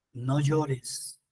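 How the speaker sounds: low, soft consoling words; gentle consoling. A narrator's reading. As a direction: drop low and soft to comfort a mourner. Jesus consoles the grieving widow.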